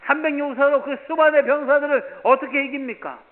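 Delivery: loud, high impassioned preaching; full-force preaching. Acted. Pastor preaching loudly to the congregation about David.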